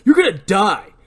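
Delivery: enraged friend attacking the suspect; angry threat. Acted. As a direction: yell it as you swing the punch. Barry punches Ray.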